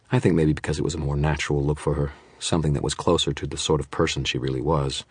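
ordinary delivery

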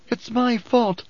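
high-pitched anguished self-blame; groaned. A narrator's reading. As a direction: groan it out in guilt. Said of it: "Alia groaned".

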